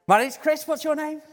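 rousing the congregation. Shown as a demonstration. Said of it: "Should we do that again?".

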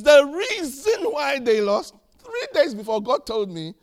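loud, high, drawn-out article quote; slow, booming parody of the writer; mock proclamation. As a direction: declaim the apostle's excuse mockingly. Mocking the apostle's new article.

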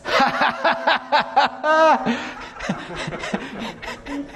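bursts of high, delighted laughter. Real, not acted. Preacher laughing as he recalls praying for his friend.

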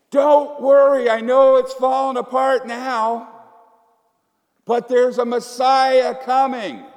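loud, slow hopeful cry. Pretend. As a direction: call out a promise across the crowd. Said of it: "someone crying out saying".

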